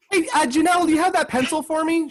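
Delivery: high, bright asking voice; mock schoolmate voice. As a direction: play a classmate asking for the pencil. Acting out a schoolmate's request.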